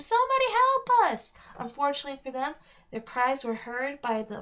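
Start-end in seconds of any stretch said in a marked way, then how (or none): 0.0-1.3 high, loud desperate scream. Acted. Alex calling for rescue from the net, screaming. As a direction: cry out to anyone in the forest.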